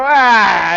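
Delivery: loud, drawn-out "ah"; mock yell. Turnstile jumper answering a cop, mock yell. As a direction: throw back a loud "ah".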